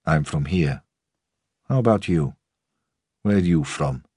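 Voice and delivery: lightly voiced old groundsman with a Russian accent; guarded, sharp, Russian-accented reply. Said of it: "The accent was Russian".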